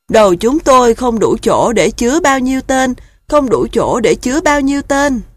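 high, loud whining goose chicks; complaining goslings. A narrator's reading. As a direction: whine like overloaded little geese. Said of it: "Các ngỗng con kêu thế". (giọng ngỗng con than thở)

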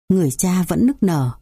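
plain reading voice

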